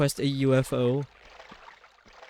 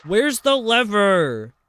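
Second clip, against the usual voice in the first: loud, high, drawn-out wail; exasperated call; 6 semitones higher, 8 dB louder, much slower than usual. Real, not acted. Streamer hunting for a lever in the game, exasperated wail. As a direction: cry out slowly and high, stretching the words.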